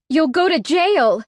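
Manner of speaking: high, loud panic; panicked. A narrator's reading. Heroine panicking at Jackson's threat, high and loud. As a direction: blurt it out in panic. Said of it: "hearing the panic in my voice".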